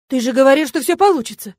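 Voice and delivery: indignant outcry. A narrator's reading. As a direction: complain loudly, feeling let down. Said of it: "возмутился кто-то".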